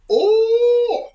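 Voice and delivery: sudden high loud "Oh"; excited oh. Real, not acted. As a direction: burst out with it. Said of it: "got a little bit excited there".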